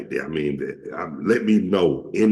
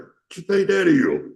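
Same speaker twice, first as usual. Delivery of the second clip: another man scoffing; 11 semitones higher, 4 dB louder than usual. Retold or imitated. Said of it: "another man said that to me".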